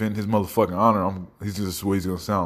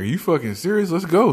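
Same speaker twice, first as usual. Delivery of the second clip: higher-pitched, eager acted reply; excited; 9 semitones higher than usual. Gullible kid answering his friend's party news, excited. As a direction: jump at the news, mock-thrilled.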